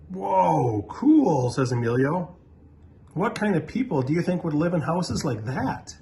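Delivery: put-on a kid wowed by a photo; amazed kid.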